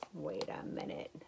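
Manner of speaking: soft, slow whisper; whispered aside. Real, not acted. Teacher whispering a doubt to the kids. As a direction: hush it like a secret.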